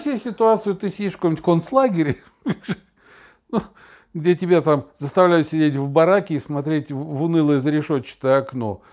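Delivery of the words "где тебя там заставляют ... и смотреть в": ordinary talk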